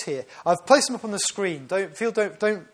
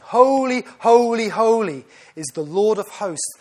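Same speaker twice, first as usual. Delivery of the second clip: seraphim calling out; 3 semitones higher, 8 dB louder than usual. Acted. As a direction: call it out like angels crying to each other. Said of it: "The seraphim calling to one another".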